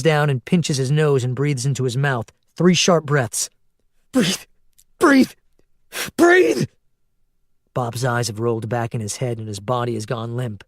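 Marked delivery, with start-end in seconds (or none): 4.1-6.7 each cry higher and louder; building desperate shouts. A narrator's reading. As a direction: scream at him, rising each time. Doing CPR, desperate.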